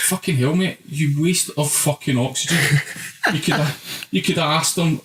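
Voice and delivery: ordinary talk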